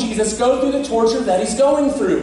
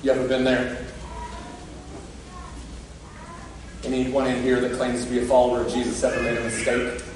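much softer and lower, pleading and intimate; hushed preaching; 11 semitones lower, 8 dB quieter than usual. Acted. Preacher softly asking the congregation about their own failures, hushed. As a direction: lean in and let the words drop to a near whisper. Quiet reflective turn after the rooster.

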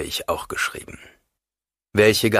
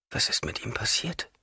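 hushed, fearful whisper; 5 semitones higher, 7 dB quieter than usual. Acted. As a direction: ask it under your breath. Realisation, fearful question.

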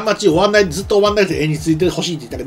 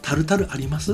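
timid request; 4 semitones lower, 8 dB quieter than usual. Retold or imitated. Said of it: "気が弱いんでしょ？".

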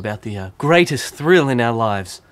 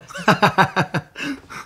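loud high laugh; 8 semitones higher, 3 dB louder than usual. Real, not acted. Laughter after wallet line.

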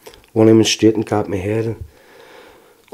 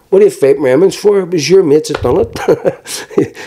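exasperated shout; 6 semitones higher, slower than usual. Retold or imitated. Son telling off drunk dad fighting police, exasperated shout. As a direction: raise your voice in pleading exasperation.

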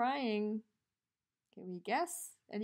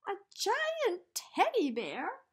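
put-on higher, louder small-boy voice, wondering; Eddie's voice; 10 semitones higher, 6 dB louder than usual. A narrator's reading. Reading to children, Eddie meets the giant teddy in boy voice. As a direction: voice a curious little boy in the dark. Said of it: "said Eddie".

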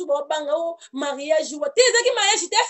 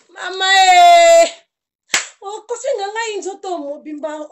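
crying out; 5 semitones higher, 8 dB louder than usual. Real, not acted. Emotional outcry at the party.

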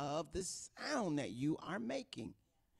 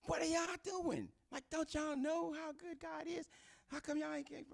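excited outburst. Retold or imitated. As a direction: push it up high, wound up at the crowd. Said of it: "The other part of me is like".

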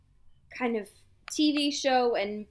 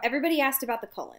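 rushed correction; much faster than usual. Real, not acted. Correcting cohost quickly.

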